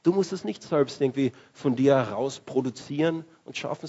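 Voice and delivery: normal talking voice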